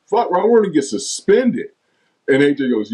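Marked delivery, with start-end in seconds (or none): 0.1-1.7 high-pitched retold alarm; retold exasperation. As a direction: break bad news to a clueless teammate, pitched up. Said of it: "Jack goes".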